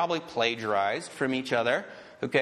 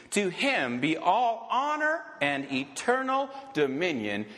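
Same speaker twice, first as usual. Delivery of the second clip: solemn scripture reading; 6 semitones higher than usual. Acted. Scripture reading over music.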